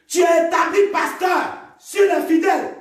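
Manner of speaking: high, forceful cry to the church; preacher's challenge. Real, not acted. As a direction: call out the pastors, pitched high. (interpellation criée, voix haute)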